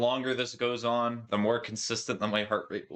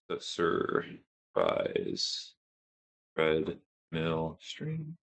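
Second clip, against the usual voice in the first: dramatic announcer voice; 4 semitones lower, much slower than usual. Pretend.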